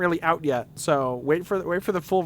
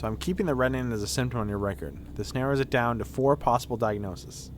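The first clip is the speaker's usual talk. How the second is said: low, soft doctor voice; composed game doctor; 7 semitones lower, 5 dB quieter than usual. Acted. Doctor noting a patient's symptom on the record, low and composed. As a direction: think through the case as a doctor, lowly. Reads the game's doctor aloud.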